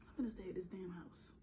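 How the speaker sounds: conspiratorial whisper. Pretend. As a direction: whisper it like a secret plan. Joking about skipping work.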